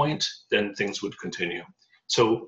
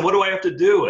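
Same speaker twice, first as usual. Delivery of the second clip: louder and much higher, bursting; eager excited question; 9 semitones higher, 7 dB louder, faster than usual. Retold or imitated. Asking his wife what to do next, loud and eager. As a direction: blurt it out, can't wait to start. Eager after wife's blessing.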